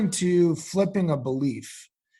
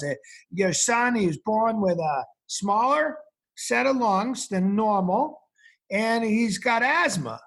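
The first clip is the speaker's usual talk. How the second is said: a higher Boston accent, a light impression of the doctor; 5 semitones higher than usual. Retelling the Boston doctor diagnosing his asthma, in a higher Boston accent. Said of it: "he talked with a Boston-y kind of accent".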